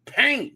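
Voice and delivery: high "Paint", the clipped start of PAY-niss; playful high-pitched "Paint"; comic pronunciation. Shown as a demonstration. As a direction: pop out "Paint" high with a long AY. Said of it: "You gotta say it with a little".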